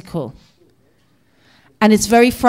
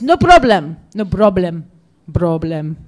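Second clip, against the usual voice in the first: put-on Arabic-accented English, falling away with each repeat; easygoing Arabic-accented reassurance; imitated Arabic accent; 4 semitones lower than usual.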